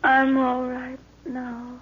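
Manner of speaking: slow, soft, half-awake; faint and dazed. Acted. Lorna waking from a faint, weak and slow. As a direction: come round weakly.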